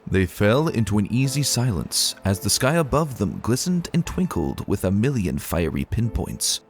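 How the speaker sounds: ordinary talk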